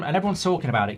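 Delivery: usual speaking voice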